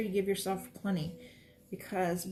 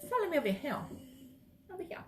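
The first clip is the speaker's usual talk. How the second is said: much higher sing-song call, trailing off softer; playful call; 9 semitones higher, 3 dB quieter, faster than usual. Acted. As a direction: lure them over in a high sing-song. Calling viewers to follow.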